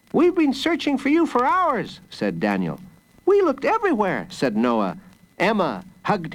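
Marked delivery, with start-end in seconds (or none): 0.1-2.0 put-on high-pitched child's complaint; high, urgent boy's voice; worried brother. A narrator's reading. Brother greets the lost boy. 3.3-4.3 put-on quick, high-pitched child's voice; high, hurried boy's exclamation; worried brother. A narrator's reading. Brother greets the lost boy.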